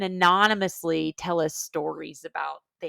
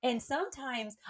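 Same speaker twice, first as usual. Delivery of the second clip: normal talking voice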